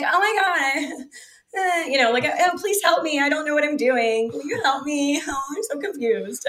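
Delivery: high, sing-song playing-dumb voice; a put-on helpless voice, laughing through it; mock helpless damsel. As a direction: ham up the clueless helpless girl. Acts helpless for seller.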